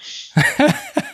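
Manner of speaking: short high-pitched laugh. Real, not acted. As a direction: laugh lightly at yourself. Laughs at his own story.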